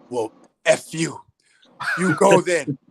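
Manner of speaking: hammed-up mother blowing up at her son; angry mother. Mother disowns him in argument.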